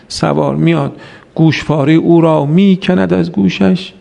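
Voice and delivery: ordinary delivery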